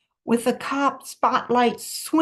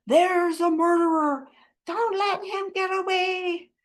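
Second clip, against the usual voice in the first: high-pitched mock yell; crowd yelling; 6 semitones higher than usual. A narrator's reading. Onlookers in the crowd point up at suspect, mild yelling. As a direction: yell it high, held back in volume. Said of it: "yelling things like".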